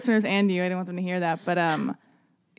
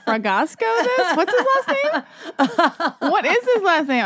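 high, loud gleeful prying; much higher giddy interrogation; excited teasing; 10 semitones higher, 4 dB louder than usual. Real, not acted. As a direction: pounce on the gossip with delight. Teasing guest about a secret.

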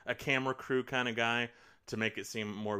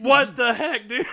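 incredulous outburst; 13 semitones higher, 15 dB louder than usual. Real, not acted. Laughing outburst.

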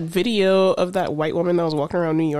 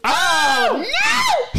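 shocked shriek. Real, not acted. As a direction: scream out in disbelief. Friend shrieks at touching.

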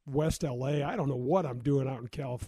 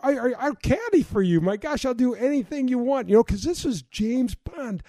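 starstruck; 6 semitones higher, 4 dB louder than usual. Retold or imitated. Retelling his starstruck reply.

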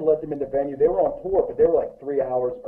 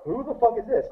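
angry; 10 semitones higher than usual. Retold or imitated. Angry girls demanding answers.

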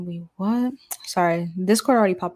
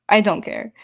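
louder repeat; 2 semitones higher, 6 dB louder than usual. Real, not acted. Repeats phrase, then louder.

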